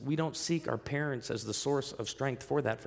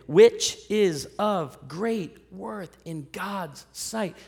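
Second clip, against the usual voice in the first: slow, higher scripture reading; slow emphatic reading; 6 semitones higher, 6 dB louder, much slower than usual. Acted. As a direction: lean into each word of scripture. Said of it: "read this next part with me".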